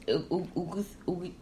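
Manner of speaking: "U-, U-, Ugus" restarted, halting on each try; stumbling pronunciation. Real, not acted. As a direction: stammer out "Ugus" unsure how to say it. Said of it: "I, I'm saying it wrong".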